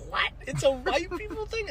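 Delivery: high-pitched mock incredulous question; high put-on disbelief; imitating amused friend. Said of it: "she just starts dying laughing".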